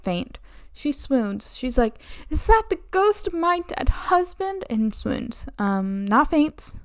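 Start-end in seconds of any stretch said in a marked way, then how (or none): 2.3-4.6 mock swoon. Countess swoons seeing her son.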